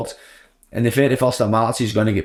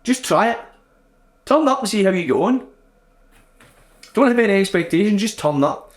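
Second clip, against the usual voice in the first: higher-pitched gentle nudge to yourself; retold inner pep talk in a raised pitch; coaxing self-talk; 8 semitones higher than usual. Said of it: "I was kinda like to myself".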